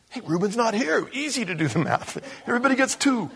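high, sly voice splitting the money; mock scheming brothers. Brothers gloating to each other over the money, high and sly. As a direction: play the brothers as smug schemers.

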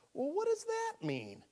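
high, drawn-out puzzled question; high-voiced wondering; puzzled questioner. Voicing a puzzled listener's question.

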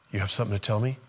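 soft, low, tentative question; hushed listening. Acted. Elijah finally listening to God, hushed and low. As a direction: ask it quietly, keeping the voice small.

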